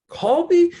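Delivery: a sudden shocked squeak; shocked disbelief. Retold or imitated. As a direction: voice jumps high and loud in disbelief. Shocked at wife's words, retold.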